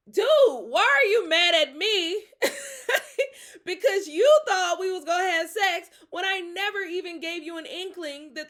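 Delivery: loud, high, laughing outrage; laughing rant. Real, not acted. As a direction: laugh and yell at him at once.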